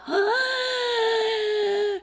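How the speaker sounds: high, sharp theatrical gasp; a big pretend gasp of horror; mock shocked gasp. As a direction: gasp as if scandalized. Family reacts to dad's swear.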